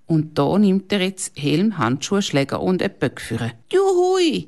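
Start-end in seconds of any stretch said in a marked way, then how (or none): 3.7-4.5 high, loud gleeful shout; kid's loud cheer of joy; yelling. A narrator's reading. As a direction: whoop it across the ice. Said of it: "brüllt der David".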